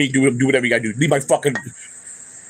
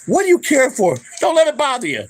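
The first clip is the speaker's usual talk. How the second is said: mocking people's advice; 9 semitones higher than usual. Ranting vlogger mimics people telling him not to care, high mocking voice. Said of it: "the people that keep telling me".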